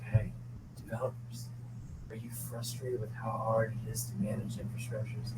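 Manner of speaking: hushed, very low voice-over parody; Roman Mars-like ad voice. Retold or imitated. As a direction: sell it softly and intimately, deep and smooth as liquid chocolate. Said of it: "I fucking sound like Roman Mars".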